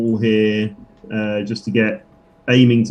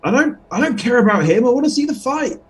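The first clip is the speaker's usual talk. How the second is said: pitched way up, whiny and eager; a high voice begging to get past; high pleading character voice; 11 semitones higher than usual. Acted. Character begging the bugbear to let him through, high whiny voice.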